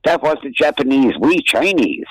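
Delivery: high-pitched mock Chinese accent; bad Chinese accent. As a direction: imitate a restaurant owner in a put-on Chinese accent. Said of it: "doing bad Chinese accents".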